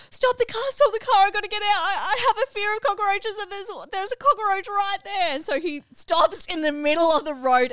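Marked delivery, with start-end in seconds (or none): 0.1-5.3 high, loud, frantic begging to stop; panicked cry to the driver to pull over; panicked plea. Retold or imitated. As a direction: shriek it like the bug is on you. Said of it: "say to the driver".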